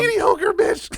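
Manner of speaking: high, slow mocking echo of the phrase. Mocking his friend's words in a high, slow voice. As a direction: drag it out high to mock him.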